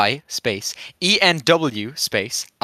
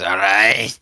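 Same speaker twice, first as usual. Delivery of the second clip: 1 imitating robot voice; 3 semitones lower, 4 dB louder than usual. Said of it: "it's just a robot saying".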